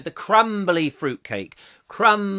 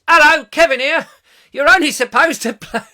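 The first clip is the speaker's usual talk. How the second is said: louder, higher cockney film-star voice; Michael Caine impression; 10 semitones higher, 11 dB louder than usual. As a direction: deliver it like a cockney film hard man. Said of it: "Could be Michael Caine".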